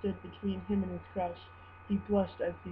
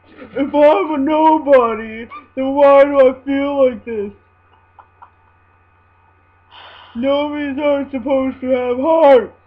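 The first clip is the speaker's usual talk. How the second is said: anguished cry; 5 semitones higher, 15 dB louder than usual. Acted. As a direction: cry out in heartbreak. Heartbroken character cries out.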